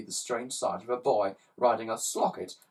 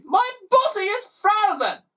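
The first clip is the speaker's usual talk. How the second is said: alarmed cry; 9 dB louder than usual. Acted. Mister G cries out.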